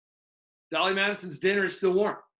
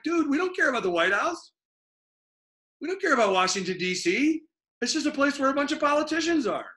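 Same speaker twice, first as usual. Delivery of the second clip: high, casual mock shrug of a voice; dismissive Americans; 8 semitones higher than usual. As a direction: brush it off like a bored dude.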